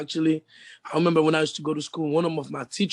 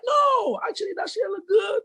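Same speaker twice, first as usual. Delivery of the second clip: mock excited shout. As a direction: shout it like friends hyping you up. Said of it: "They be like".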